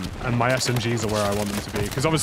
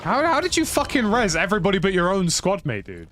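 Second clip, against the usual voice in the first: exasperated; 7 semitones higher than usual. Real, not acted. Teammate failed to revive him.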